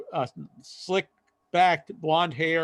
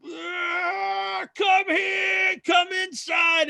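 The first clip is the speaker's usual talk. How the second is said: put-on drunken shout; much slower than usual. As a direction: bellow it like a drunk pulling a buddy in. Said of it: "And he went".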